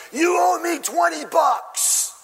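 high, slow, hammed-up shout; furious demand, acted. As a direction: roar it as if grabbing his throat. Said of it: "he grabs him by the throat".

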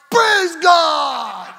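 loud, high, jubilant church shout; mock praise shout. Ladies celebrating the boy's tongues, loud mock-ecstatic shout. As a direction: shout it like a congregation in rapture. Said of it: "They're all".

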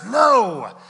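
shouted, firm no; emphatic shout. Real, not acted. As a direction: cut the excuse off hard. Preacher rebuts loudly.

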